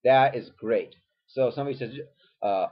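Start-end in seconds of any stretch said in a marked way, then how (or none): none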